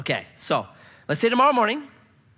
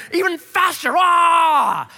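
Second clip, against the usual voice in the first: higher, louder shout urging more speed; reckless shout; 8 semitones higher, 7 dB louder, much slower than usual. Retold or imitated. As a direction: yell it like a thrill-crazed driver. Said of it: "you're like".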